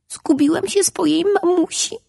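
put-on high little boy, close to tears; a lost child telling his trouble. A narrator's reading. Lost ray boy speaks. (wysoki głos zagubionego malca)

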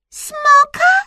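very high, loud squeal; tiny mouse shriek, rising; squeaky mouse. A narrator's reading. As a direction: squeal the guess in astonishment. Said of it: "zapiszczała Myszka".

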